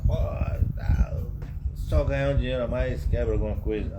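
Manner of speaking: retold cursing. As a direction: grumble loudly in mock annoyance. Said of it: "Eu xingava". (resmungando, imitando um xingamento)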